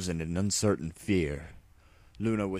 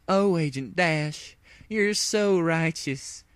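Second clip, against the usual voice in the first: high, slow lovestruck moan; hammed-up swooning; 10 semitones higher, slower than usual. A narrator's reading. Applejack gazing at her savior, high and slow. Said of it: "Applejack moaned".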